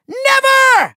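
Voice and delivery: high, theatrical villain yell; defiant cry. Acted. Villain swearing his Doomlander is unbeatable, defiant cry.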